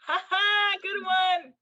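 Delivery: high, loud fake laugh-off; mock amused. Laughing off a spirit's scare, high and mocking. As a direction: brush it off with a bright sarcastic laugh. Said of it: "if you just go".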